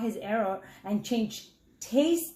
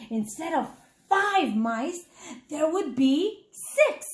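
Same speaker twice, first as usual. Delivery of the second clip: usual speaking voice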